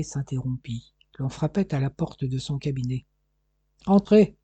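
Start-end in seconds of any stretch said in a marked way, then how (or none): 3.9-4.4 crié. A narrator's reading. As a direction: appelle fort à travers la porte. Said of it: "cria-t-il". (crié vers la porte qu'on frappe)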